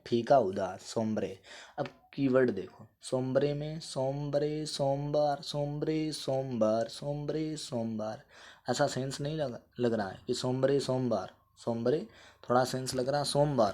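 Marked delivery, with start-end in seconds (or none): none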